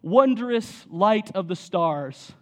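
slow, hammed-up solemn prayer voice; mock a vague chapel prayer, drawn out slowly; vague lofty prayer. Pastor mocks vague chapel prayers, slow and hammed-up solemn. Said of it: "The prayers would start like this".

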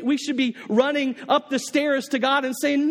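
ordinary talk, nothing marked

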